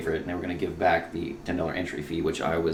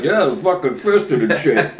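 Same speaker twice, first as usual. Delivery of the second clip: slow, loud, mocking dumb voice; hammed-up dopey imitation of a friend; 7 semitones higher, 10 dB louder, slower than usual. Mocking a friend's fisting jokes, loud and slow. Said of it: "And you're all like".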